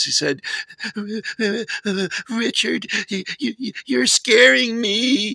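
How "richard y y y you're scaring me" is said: trembling voice. Retold or imitated. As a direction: squeak it out like a frightened girlfriend. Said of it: "in a trembling voice".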